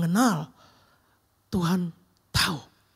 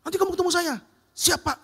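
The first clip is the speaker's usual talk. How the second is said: angry boss order; 9 semitones higher, 4 dB louder, faster than usual. Retold or imitated. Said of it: "Udah marah-marah kan".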